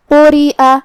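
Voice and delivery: قُرِئَ with heavy qaf and light ra; heavy قاف, light را in قُرِئَ. Shown as a demonstration. Teacher showing tafkheem and tarqeeq. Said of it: "قاف کو ہم تفخیم سے ادا کریں گے".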